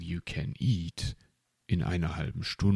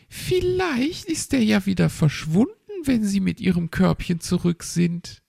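chirpy magpie; 13 semitones higher, 4 dB louder than usual. Acted. Frau Elster speaks to the owl.

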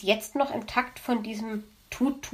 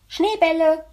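higher and louder, a squeaky delighted little hedgehog; gleeful child voice; 7 semitones higher, 7 dB louder than usual. Acted. Little hedgehog answers his mother, squealing with glee. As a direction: chirp it like an overjoyed small child. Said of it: "quietschvergnügte Kinderstimme".

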